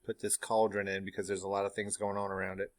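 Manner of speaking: ordinary talk, nothing marked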